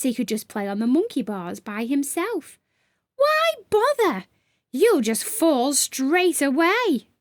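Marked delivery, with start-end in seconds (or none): 3.2-7.1 high teasing creature voice. Acted. Gray teasing Sam about the monkey bars, high sly taunt. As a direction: needle him, high and sly. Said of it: "teased Gray".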